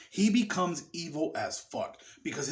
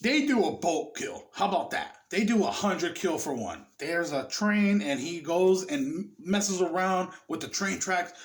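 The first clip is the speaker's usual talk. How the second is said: ordinary talk, nothing marked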